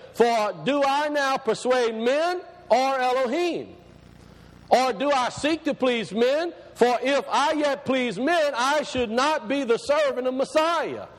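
stretched-out, proclaiming Bible verse; scripture reading. Acted. Reads scripture aloud to congregation.

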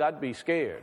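ordinary talk

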